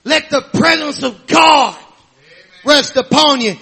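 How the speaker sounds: ordinary talk, nothing marked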